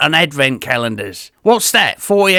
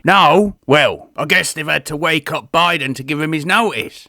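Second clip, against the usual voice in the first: ordinary talk, nothing marked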